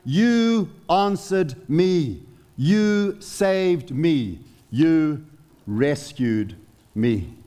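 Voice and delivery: very slow, each phrase weighed out; slow solemn reading. Shown as a demonstration. Preacher reading the psalm's turn to his flock, slow and solemn. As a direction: let every word of the rescue land slowly. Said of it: "it literally reads something like".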